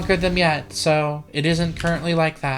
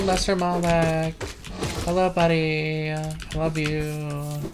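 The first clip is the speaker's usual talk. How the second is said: tender. Pretend. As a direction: coo it lovingly to the little bug. Tender words to a game creature.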